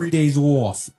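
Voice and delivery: mock Long Island accent on three days off. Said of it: "Long Island accent".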